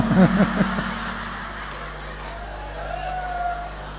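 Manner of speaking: breathless squeal of a laugh; high airy laugh. Real, not acted. Preacher laughing at his wife's prayer.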